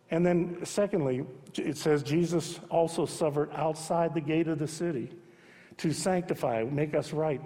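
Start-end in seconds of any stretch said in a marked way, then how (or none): none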